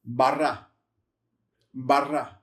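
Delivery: "Barra" with rolled r, falling; model pronunciation. Shown as a demonstration. Teacher models the finished word. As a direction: say "Barra" carefully, rolling the r. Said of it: "So how's that pronounced?".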